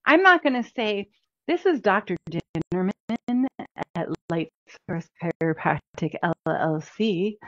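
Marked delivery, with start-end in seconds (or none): 1.5-7.4 stiff corporate phone intro; stiff formal greeting. Shown as a demonstration. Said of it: "It's not the way that I talk".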